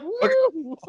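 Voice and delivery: high squeaky mock woo. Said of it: "You're like".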